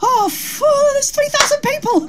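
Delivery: high breathless mock panic. Comedian seeing the lit crowd, mock panicked gasp.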